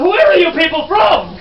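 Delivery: loud, high, drawn-out disbelief; mock outrage. Guide scolding the crowd for a wrong answer, mock outrage. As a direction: exclaim in theatrical exasperation.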